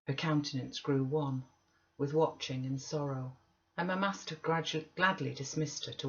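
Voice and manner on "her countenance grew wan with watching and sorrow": ordinary delivery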